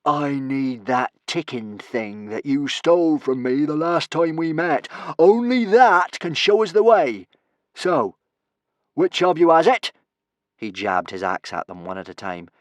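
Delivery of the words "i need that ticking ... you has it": loud, high, theatrical bully; hectoring captor interrogating prisoners; menacing villain's demand. Acted. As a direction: demand it back, building in force. Villain demands the ticking thing.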